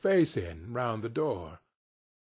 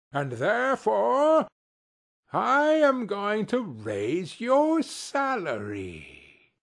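slow, higher, drawn-out announcement; joyful reveal; 7 semitones higher, slower than usual. Acted. Scrooge surprising Bob with a raise, slow higher reveal. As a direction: turn the threat into delight.

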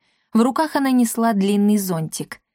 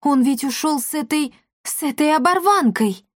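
agitated, higher-pitched voice; worried; 6 semitones higher than usual. A narrator's reading. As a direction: fret aloud, pitched up. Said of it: "заволновалась Дарина". (взволнованно, с тревогой)

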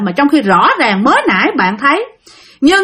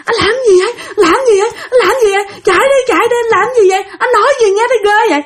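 high, frantic, half-laughing terror; panicked pleading; 12 semitones higher, much faster than usual. Retold or imitated. Girlfriend panicking at her possessed boyfriend, high frantic pleading. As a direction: cry out in panic, much higher. Terrified girl pounding boyfriend's back.

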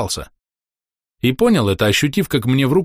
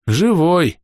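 ordinary delivery

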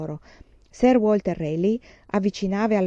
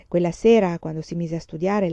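ordinary delivery

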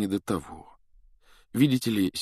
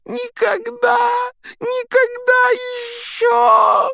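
high, slow sobbing shout; wailing; 9 dB louder, much slower than usual. A narrator's reading. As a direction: wail it out, overwhelmed. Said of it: "голосил он".

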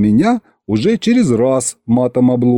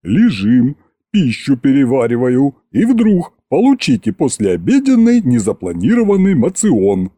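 grumpy dragon head. A narrator's reading.

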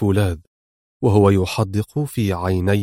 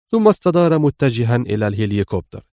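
plain reading voice

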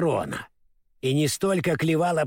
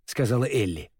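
plain reading voice

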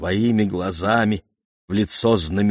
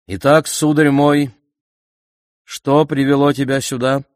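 loud, raised voice of a ruler; hearty king; 7 semitones higher, 8 dB louder than usual. A narrator's reading. Oedipus questions the newly arrived old man, hearty and loud. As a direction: address the visitor loudly, like a king.

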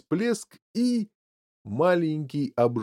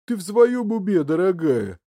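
high, loud start falling into grumble; displeased grumble; 6 semitones higher, 4 dB louder than usual. A narrator's reading. Husband refusing his wife's order, displeased. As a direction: protest irritably, dropping off. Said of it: "недовольно пробурчал мистер Глуп".

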